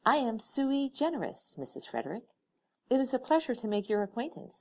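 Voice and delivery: bow slightly as you speak; formal gentleman voice. Acted. Courtly stranger voiced in story.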